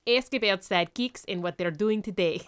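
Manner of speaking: heavy put-on Russian accent; mock Russian accent. Co-host reading a listener's tip, Russian accent. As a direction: read it out like a Russian. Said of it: "say it in Russian accent".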